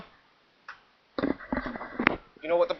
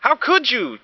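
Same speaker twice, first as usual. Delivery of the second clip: yelling; 11 semitones higher than usual. Acted. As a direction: cry out at the wrecked tower. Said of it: "yelled the Iguanodon".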